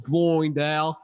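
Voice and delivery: put-on slipping into an Australian accent; slow, playful Australian twang; Aussie accent. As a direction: say Blind like "Bloind", Aussie-style. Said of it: "I'm going Aussie now".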